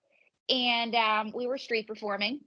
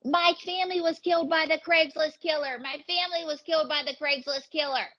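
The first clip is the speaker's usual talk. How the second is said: imitating a hysterical woman, high-pitched; mock a screaming woman, voice pushed high; 6 semitones higher than usual. Retelling an audience member's outburst, mock screaming. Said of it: "this woman starts screaming".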